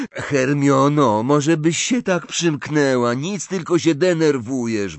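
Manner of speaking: loud, high, exasperated complaint; snarling. A narrator's reading. Ron tells Hermiona to shut up, snarling. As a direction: snap irritably at a fretting friend. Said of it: "warknął Ron".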